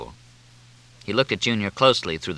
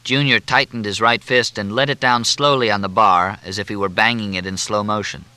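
ordinary delivery

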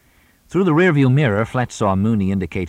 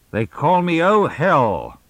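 slow, higher, resonant declaiming; booming announcement; 10 semitones higher, 4 dB louder, slower than usual. A narrator's reading. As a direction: proclaim it like an old stage star. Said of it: "his voice resonating in the closed car".

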